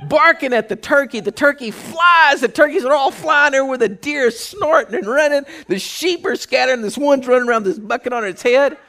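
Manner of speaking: high, loud, breakneck pile-up of events; excited storytelling. Real, not acted. Storyteller describing turkeys, deer and sheep scattering, loud excitement. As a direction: build the chaos with high, loud energy. Telling the chaos at its height.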